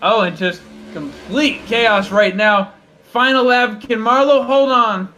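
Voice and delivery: high, loud final-lap call; rising to a shout on the last lap; motorsport commentator. Real, not acted. Final lap, chaos on track.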